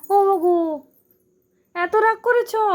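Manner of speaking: exaggerated surprise to coax a child; mock surprise. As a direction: tease the sulking little one with exaggerated amazement. Teasing a sulking child.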